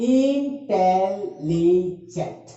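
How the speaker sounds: Intelligent very slow, in four separate held syllables; broken into syllables. Shown as a demonstration. Teacher splitting a word to count, intelligent syllable by syllable. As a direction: stretch out each syllable of intelligent. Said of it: "अगर इसको भी तोड़ेंगे".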